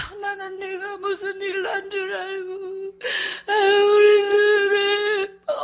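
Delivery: put-on high, slow, sobbing old mother's voice; mother crying. Mother hears her daughter is alive, sobbing high and slow. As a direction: wail it out through tears, stretching each word. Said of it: "엄마가 울어".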